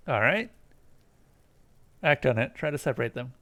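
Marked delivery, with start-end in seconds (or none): none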